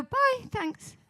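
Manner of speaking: put-on high, offhand bye of a kid leaving home; breezy teen goodbye. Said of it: "And then they go".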